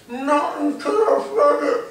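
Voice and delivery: high, loud, cheeky fox voice; fox taunt. Acted. The fox taunts Harry while fleeing, high and cheeky. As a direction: tease him as you run off.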